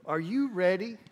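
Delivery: ordinary talk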